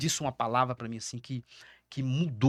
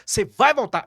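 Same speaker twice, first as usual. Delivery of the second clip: loud, higher commanding shout; father shouting the son back to life, loud and high; retold shout; 6 semitones higher, 7 dB louder than usual. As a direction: order him back with a shout. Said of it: "E ele gritou comigo".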